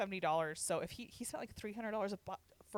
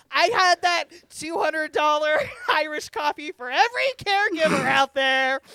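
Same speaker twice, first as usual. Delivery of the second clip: raised, laughing mock toast; loud mock toast; 8 semitones higher, 16 dB louder than usual. Toasting all caregivers loudly in mock solemnity. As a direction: proclaim it like a grand toast.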